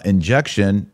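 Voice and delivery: normal talking voice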